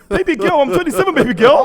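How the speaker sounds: high, loud laughing brag; playful boast. Pretend. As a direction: crow it out, teasing. Playful boast about his age.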